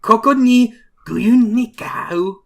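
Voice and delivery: loud, high, drawn-out Japanese line; hammed-up Japanese. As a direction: declaim it slowly like a Japanese battle cry. Echoes the yelled Japanese battle line.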